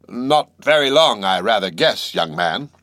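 doubtful and condescending; skeptical old man. Acted.

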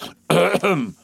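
pompous mock throat-clearing. A narrator's reading. Colonel's throat-clearing, acted.

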